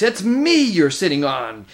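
high, loud, indignant yelling; exasperated, high-pitched complaint; angry protest. A narrator's reading. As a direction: yell at someone squashing you. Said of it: "came an angry voice".

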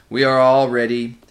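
slow, loud, ominous chant-like commands; dreadful creature summoning with force; gray, terrible voice. A narrator's reading. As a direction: intone each order heavily, as for a dark rite. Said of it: "said the gray voice".

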